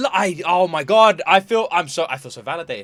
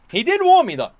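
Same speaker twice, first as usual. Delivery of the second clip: high, loud frustrated cry; exasperated outburst; 11 semitones higher, 8 dB louder than usual. Real, not acted. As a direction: blurt it out in exasperation. Exasperated rant about the warned viewer.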